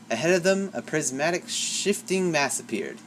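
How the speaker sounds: plain reading voice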